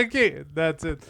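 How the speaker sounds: ordinary talk, nothing marked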